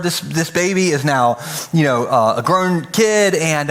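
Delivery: ordinary talk, nothing marked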